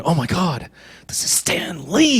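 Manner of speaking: hushed, awed fanboy gasp, stretched out; starstruck flipping out. Retold or imitated. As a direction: softly freak out, rising on the name. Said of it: "I start flipping out".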